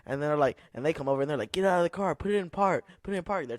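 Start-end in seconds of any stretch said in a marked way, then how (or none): 1.5-3.5 a touch of barking officers, higher; police ordering the confused driver out of her car, mild mock commands; mock cop command. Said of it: "aggressively telling her now".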